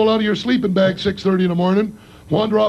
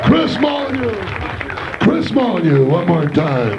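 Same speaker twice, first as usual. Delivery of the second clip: host announcement; slower than usual. Acted. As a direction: bring the comedian's name home to the crowd.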